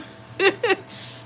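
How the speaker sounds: high, breathy chuckle; laughing. Real, not acted. Mom amused at her own good-mom claim, breathy laugh. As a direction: laugh at yourself, mostly breath.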